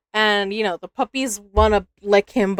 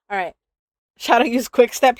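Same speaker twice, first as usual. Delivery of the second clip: ordinary talk, nothing marked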